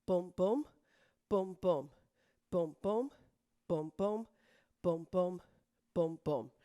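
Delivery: rhythmic dance count, punching each boom; calling counts. Shown as a demonstration. Instructor counting the class through each accent. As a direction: call the beats for the class.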